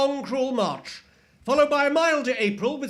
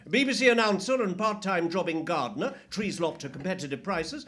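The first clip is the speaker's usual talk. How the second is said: ordinary delivery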